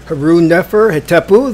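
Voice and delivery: ordinary talk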